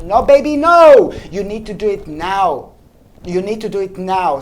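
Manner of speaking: usual speaking voice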